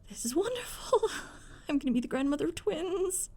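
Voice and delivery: high, tearful laughing delight; overjoyed grandmother laughing; laughing with joy. Acted. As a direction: gush through happy tears and laughter. Mother overjoyed at twins news.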